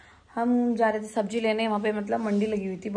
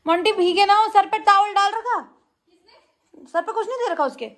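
high, loud call across the house; 10 semitones higher, 6 dB louder than usual. Real, not acted. Calling to sister about baby. (ऊँची, ज़ोर से पुकार)

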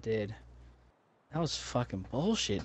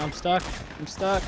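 panicked shout; 6 semitones higher, 3 dB louder than usual. Real, not acted. Player panics stuck in game.

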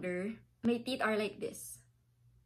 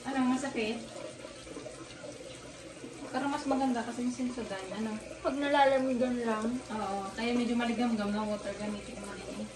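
talking around toothbrush; foamy mouth while brushing; mouth full of toothpaste. Real, not acted. Seen 0: Toothbrush in mouth with foam throughout; speaker possibly partly off-screen.

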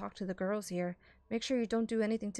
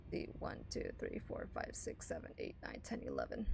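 whispered counting; 12 dB quieter than usual. Real, not acted. Counting apples under breath.

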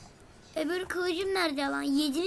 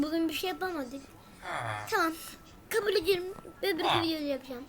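normal talking voice

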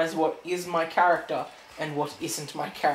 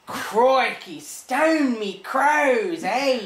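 American's drawn-out, higher mock-Australian; imitated bad Aussie accent; 6 semitones higher, 4 dB louder, much slower than usual. Australian mimicking American friend's attempt, exaggerated Aussie accent. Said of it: "you're an American going".

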